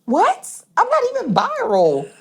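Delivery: very high, laughing disbelief; laughing, high-pitched disbelief; incredulous. Retold or imitated. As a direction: squeal it through a laugh.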